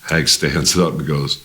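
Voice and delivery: normal talking voice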